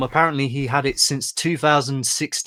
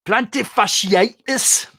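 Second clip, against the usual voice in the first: slow, higher, louder Geordie "Plantar fasciitis"; Geordie accent; 8 semitones higher, 5 dB louder, slower than usual. Shown as a demonstration. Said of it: "best word to say in a Geordie accent".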